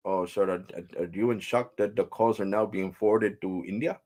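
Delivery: put-on polite Indian call-centre accent; mimicked Indian English, customer-service manner; imitated Indian agent. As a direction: ask like an Indian phone agent. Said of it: "I'm talking like he was talking to me".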